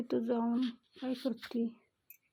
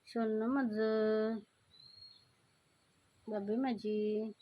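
tender cooing; much slower than usual. Real, not acted. Owner cooing pet names to her cat, slow and tender. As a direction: coo lovingly to a pet.

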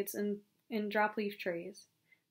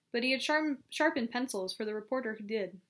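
ordinary delivery, nothing marked

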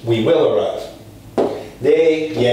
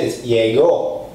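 llegó with the stress on the last syllable, ó; slower than usual. Shown as a demonstration. Teacher showing the accent to students, stressing the final ó. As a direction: lean on the ó of llegó. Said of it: "notice another accent".

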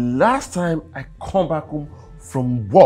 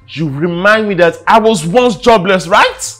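louder, rising sneer; angry accusation; 6 semitones higher, 10 dB louder than usual. Acted. Husband accusing wife of taunting him, angry accusation. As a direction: fling it back at her.

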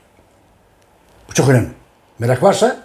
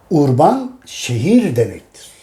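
each word drawn out slowly; slow, deliberate; much slower than usual. Shown as a demonstration. Teacher repeating for students to note down, slow. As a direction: spell out the meaning very slowly for the class.

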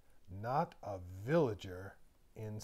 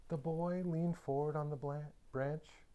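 ordinary delivery

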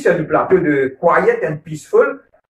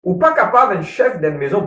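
ordinary talk

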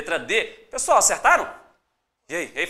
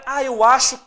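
mock unsure; 4 semitones higher, 8 dB louder than usual. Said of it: "não é".

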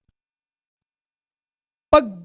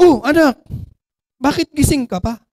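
louder, startled father voice; surprised father; 4 semitones higher, 7 dB louder than usual. Acted. Father coming home late, surprised to see son, startled. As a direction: catch the child still up, startled.

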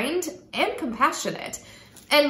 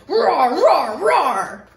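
louder roaring bear voice; 6 dB louder, much slower than usual. Acted. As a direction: roar it out, half laughing at the end. Bear stomping through the meadow.

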